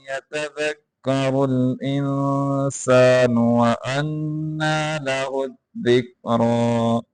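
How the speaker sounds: slow melodic tajweed chanting; Quran recitation. Acted. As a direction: draw each verse out in recitation.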